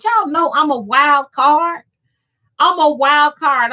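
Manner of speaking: slow and high, savoring each word; proud boast. Real, not acted. To her live chat, boasting she's a wild card, slow and high.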